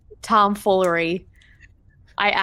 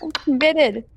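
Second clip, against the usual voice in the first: lightly put-on Kentucky accent, pitched higher; 7 semitones higher, slower than usual. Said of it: "I would start sounding like them".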